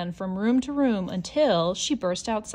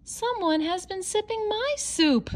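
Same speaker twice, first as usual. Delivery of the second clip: high, cross Mama Bear voice; annoyed mother bear; growling Mama Bear; 13 semitones higher than usual. Acted. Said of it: "she growled".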